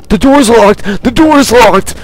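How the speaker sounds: high, loud, frantic repeat; panicked cry. Real, not acted. Player fleeing a zombie at a locked door, panicked cry.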